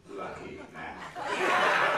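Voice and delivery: lucky man drawn out very slowly, high and loud; Lindsay savouring it. Retold or imitated. Lindsay tasting the new title aloud, slow, high and loud.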